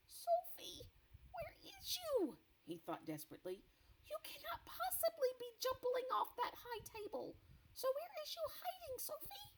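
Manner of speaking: high, desperate friendly giant calling; giant BFG voice. Acted. BFG searching the cave for Sophie, high and anxious. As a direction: call out for Sophie, high and worried.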